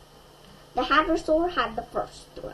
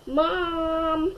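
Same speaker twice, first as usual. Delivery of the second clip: a high mock yell of Mom; a read-aloud kid's shout, not full force; child's yell; 6 semitones higher than usual. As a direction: call out to Mom, lightly raised. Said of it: "I yelled".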